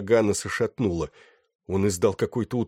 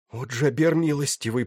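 higher-pitched, voiced plea with a touch of dread; mock whispered plea; 6 semitones higher than usual. A narrator's reading. Officer about to be shot, pleading in mild fear. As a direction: pray in understated fear, pitch lifted. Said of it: "прошептал он".

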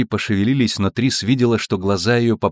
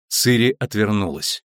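usual speaking voice

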